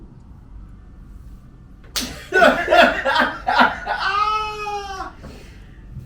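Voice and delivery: loud, high, drawn-out laughing fit; long high laugh. Real, not acted. Friend cracking up at round two, long laugh. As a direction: let a long delighted laugh roll out.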